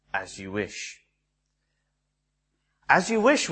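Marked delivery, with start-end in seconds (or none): 0.1-1.0 much lower and softer, a hushed devoted answer; Westley answering Buttercup, low, soft and devoted; devoted reply. Retold or imitated. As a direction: murmur it like a quiet vow of love.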